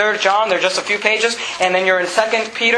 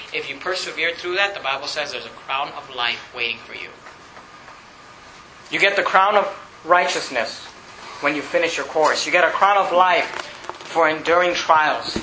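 much softer and lower, tender reassurance; gentle encouragement; 5 semitones lower, 4 dB quieter than usual. Real, not acted. Pastor quietly promising the sick a crown of life, soft and tender. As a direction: ease down and comfort the ones who are struggling.

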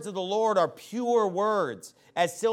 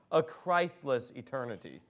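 mocking euphemism; 6 semitones lower, 5 dB quieter than usual. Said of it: "how about this one".

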